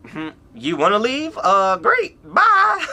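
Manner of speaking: breezy fake-cheerful brush-off; mock dismissive parent. Imitating how any other parent would wave off a kid leaving, sarcastic. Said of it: "any other parent, they would have been like".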